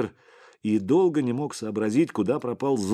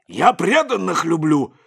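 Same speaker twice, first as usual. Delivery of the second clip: high, very loud outburst; angry shout; 9 semitones higher, 9 dB louder than usual. A narrator's reading. Boss answers his bodyguard's challenge, shouting furiously. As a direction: yell it in his face. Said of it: "закричал Сипякин".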